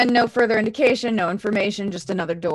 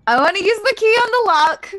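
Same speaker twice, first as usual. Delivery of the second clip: high, loud whiny demand; childish whine; 11 semitones higher, 7 dB louder than usual. Acted. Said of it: "You sometimes act like a child".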